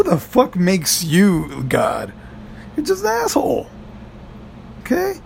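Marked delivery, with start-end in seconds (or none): 2.8-5.2 high mocking jab. Mocking the Saw villain.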